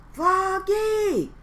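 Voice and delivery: high loud call across the parade; father calling. Acted. Father shouts to Froggy from the sidelines, loud and high. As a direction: call out to get his son's attention. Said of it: "called his father".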